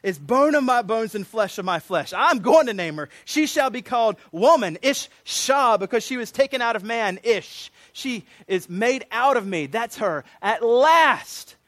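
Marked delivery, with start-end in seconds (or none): 0.0-9.7 raised, exultant poetic declaration; emotional outburst. Acted. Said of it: "like, the emotion". 10.4-11.6 loud, high, drawn-out exultation; flood of emotion. Acted. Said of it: "like, the emotion".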